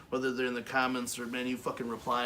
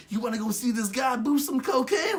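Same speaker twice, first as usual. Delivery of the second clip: random stranger; 10 semitones higher, 5 dB louder than usual. Retold or imitated. Stranger voiced.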